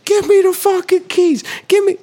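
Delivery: high-pitched mock street scream; imitated scream. Imitating a guy yelling across the street at dawn, screaming. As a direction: scream it like an irate man down the block. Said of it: "screaming at someone".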